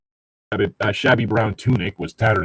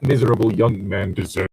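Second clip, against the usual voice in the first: ordinary talk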